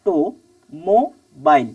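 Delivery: "Automobile" very slowly, four pieces with clear gaps; syllable by syllable. Shown as a demonstration. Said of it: "এই যে চারটে part". ("Automobile" খুব ধীরে, প্রতিটি ভাগ আলাদা করে)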